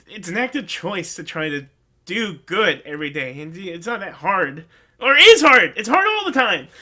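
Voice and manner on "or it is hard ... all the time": much louder and higher, insisting; sudden loud, high-pitched insistence; emphatic outburst. Real, not acted. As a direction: burst out that it really is hard. Passionate correction of himself.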